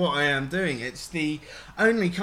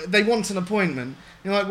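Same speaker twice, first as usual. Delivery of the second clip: ordinary talk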